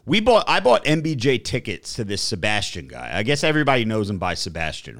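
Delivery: ordinary talk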